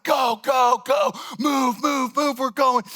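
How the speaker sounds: high shouted hurry-up; retold frantic shouting, pitched high. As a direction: yell at the lines to move. Urging the crowd to hurry.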